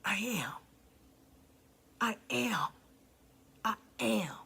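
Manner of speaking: soft, low, whispered vow; hushed sincere commitment; quiet resolve. Real, not acted. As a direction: promise it to yourself. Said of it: "repeated commitment".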